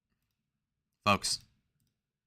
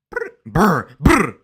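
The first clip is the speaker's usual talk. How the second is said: loud trilled "brr"; rapper's ad-lib; 6 semitones higher, 10 dB louder, much slower than usual. Retold or imitated. Streamer imitating [name] after naming him, trilling loudly. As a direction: roll out loud, high brr noises.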